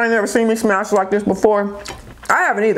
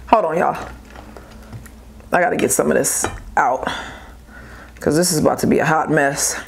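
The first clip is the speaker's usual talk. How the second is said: ordinary delivery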